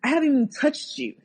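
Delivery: high, fast disbelieving retort; incredulous. Retold or imitated. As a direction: act stunned he would even say it. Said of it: "I'm like".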